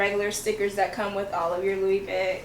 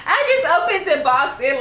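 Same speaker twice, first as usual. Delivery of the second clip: higher and louder, bursting with joy; pure joy; 6 semitones higher, 5 dB louder than usual. Real, not acted. Telling her viewers she just opened it, thrilled. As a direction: let the thrill spill out. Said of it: "it's just pure joy".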